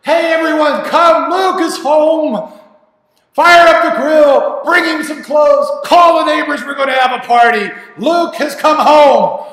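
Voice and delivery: high, full-voiced jubilant shout; building to a triumphant, drawn-out proclamation; joyful shouting. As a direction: call out to the whole household. Father proclaims son's homecoming.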